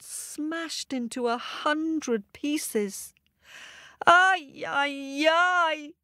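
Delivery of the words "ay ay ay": loud wailing ay ay ay; woman's wail. A narrator's reading. Woman wailing at her smashed pot. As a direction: wail over the broken honey pot. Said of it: "said the woman".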